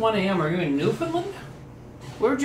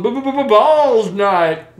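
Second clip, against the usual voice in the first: stuttered catchphrase; 4 semitones higher, 7 dB louder than usual. Pretend.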